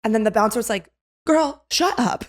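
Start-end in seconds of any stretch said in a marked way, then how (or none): 1.2-2.2 high, drawn-out mock exasperation; exasperated dismissal. Bouncer to girl arguing the math, exasperated dismissal. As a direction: slowly shut her down, pitched way up.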